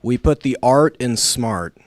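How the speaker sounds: slow, loud recited slogan; stiff memorized speech. Real, not acted. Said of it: "Here we go".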